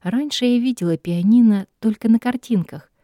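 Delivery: plain reading voice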